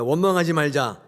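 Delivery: loud, high congregational call; call to repeat. Shown as a demonstration. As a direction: lead the congregation in a loud shout. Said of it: "우리 한번 따라하겠습니다".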